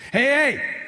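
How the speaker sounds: demonstrated yell for attention; attention-getting shout. Shown as a demonstration. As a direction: call out to make them look. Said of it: "If you gotta scream to get it".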